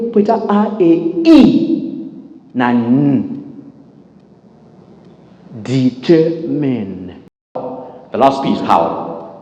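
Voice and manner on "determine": determine very slowly, syllable by syllable; model pronunciation. Shown as a demonstration. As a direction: draw out each syllable of determine. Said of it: "kuti word rinopronouncwa sei".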